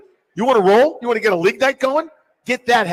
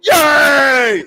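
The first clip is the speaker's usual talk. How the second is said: louder, higher cheering yell; mock cheer; 7 semitones higher, 7 dB louder than usual. Fan recalling the crowd at the game, cheering yell. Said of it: "then we'll go".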